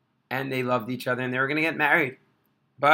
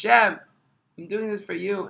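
higher, louder cry to God; anguished plea; 7 semitones higher, 3 dB louder than usual. Retold or imitated. Orphan boy begging Hashem at the wall, anguished. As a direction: call out in desperation.

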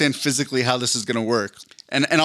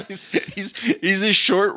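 laughing; 7 semitones higher than usual. Real, not acted. Laughing at the joke.

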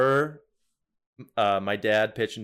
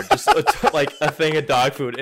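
ordinary delivery

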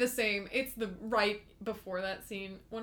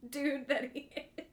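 ordinary delivery

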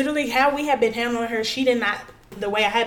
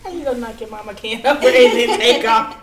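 laughing; 2 semitones higher, 8 dB louder than usual. Real, not acted. Laughing tease at co-host.

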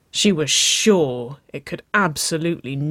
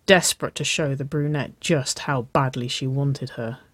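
ordinary delivery, nothing marked

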